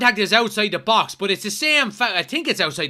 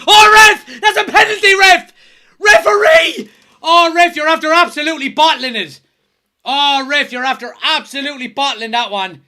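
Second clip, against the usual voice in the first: screaming much higher and louder, fading a little by the end; furious shouting at the ref; 10 semitones higher, 12 dB louder than usual. Real, not acted. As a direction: yell at the referee like he just robbed you. Fan yelling at referee over penalty.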